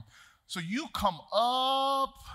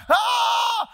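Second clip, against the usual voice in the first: high loud scream of fright; shrieking as men yell at him to get off; panicked scream; 9 dB louder than usual. Retold or imitated. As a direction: let out a sudden terrified shriek. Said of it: "and I'm like".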